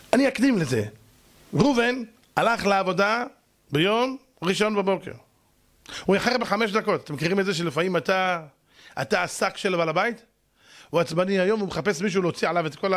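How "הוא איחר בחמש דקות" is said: normal talking voice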